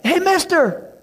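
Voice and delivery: put-on high, loud boy calling from afar; eager kid hollering for attention; boy yelling. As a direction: shout across the fence like a kid. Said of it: "He's like".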